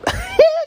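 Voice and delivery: high, sudden laugh; squeaky startled laugh; burst of laughter. Real, not acted. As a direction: cracks up in disbelief. Laughing at fish drawing.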